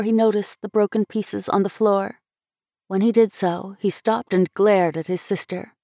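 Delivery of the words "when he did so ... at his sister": ordinary delivery, nothing marked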